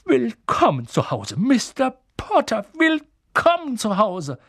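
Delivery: high, drawn-out welcome, brimming with emotion; slow, swelling, emotional old barman; overcome welcome. A narrator's reading. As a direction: welcome a long-lost hero home. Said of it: "mit Tränen in den Augen".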